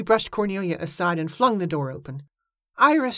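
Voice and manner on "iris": high exclaimed name; crying out. A narrator's reading. Evangeline greets long-absent Iris, crying out high. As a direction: cry out the name in an eager high voice. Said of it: "she cried".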